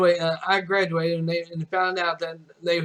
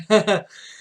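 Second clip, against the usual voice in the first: chuckle; 4 semitones higher, 7 dB louder than usual. Real, not acted. Laughing at own story.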